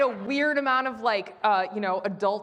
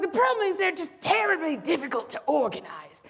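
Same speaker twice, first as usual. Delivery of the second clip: a hint of a grand society lady, a touch higher; lightly imitating a grand old hostess; 7 semitones higher than usual. Hostess complaining that orgies are hard to organize, lightly grand. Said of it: "'cause she literally talked like that".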